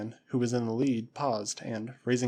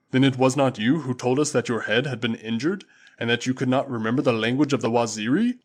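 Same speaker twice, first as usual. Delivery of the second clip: booming Waziri warrior; 6 dB louder than usual. Acted. Usula answering his master Tarzan, loud and proud. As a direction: call it out like a loyal tribesman.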